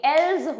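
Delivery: एल्स ending in a buzzing z, like "elz"; else with a z sound. Shown as a demonstration. Teacher showing students: एल्स ends in a z sound. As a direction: say एल्स with a voiced z at the end, not s. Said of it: "यहाँ पे आपके पास ज की sound होती है".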